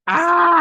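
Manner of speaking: sudden high yelp of pain; pained scream. Retold or imitated. Stabbed woman shrieking in pain. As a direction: shriek as the knife goes in.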